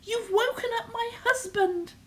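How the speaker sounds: high panicked wife's voice with a laugh; screeching wife. Acted. Giant's wife warning Jack the giant is awake, high frantic cry. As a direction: warn him in a high, frantic voice. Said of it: "screeched the giant's wife".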